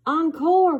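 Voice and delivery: loud, high encore shout; proud father shouting encore; dad's cry. Acted. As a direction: call it out from the seats. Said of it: "cried my dad".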